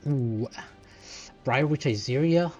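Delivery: ordinary talk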